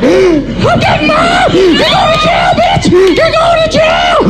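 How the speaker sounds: put-on very high, slow, screaming kid; little brother shrieking at big brother that he's telling mom; shrieking tattletale. Little brother threatening to tell.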